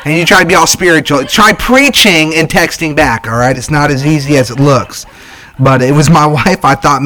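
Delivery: ordinary talk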